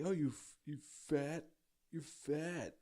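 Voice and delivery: high mocking schoolkid taunt; mocking bully kids. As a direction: sneer it like a first-grade bully. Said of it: "They would be like".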